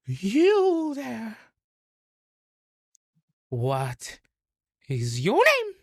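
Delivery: high, imperious frog-queen demand; bullywug queen. Acted. Bullywug queen demanding a name from the stranger, high and imperious. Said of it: "just goes".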